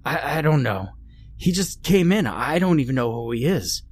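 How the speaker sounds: high, panicky stammer; frightened. Acted. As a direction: stammer it out, scared. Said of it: "clearly frightened".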